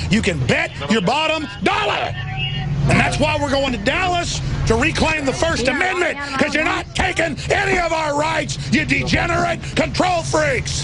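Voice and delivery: rising, fired-up yelling; angry shout. Real, not acted. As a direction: blast it at the control freaks. Angry rant against officials.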